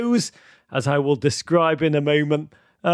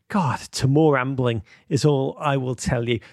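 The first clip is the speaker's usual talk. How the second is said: normal talking voice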